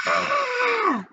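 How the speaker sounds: short high yelp of pain; high scream. A narrator's reading. Leslie shrieks when Paul yanks her pigtail. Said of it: "screamed Leslie".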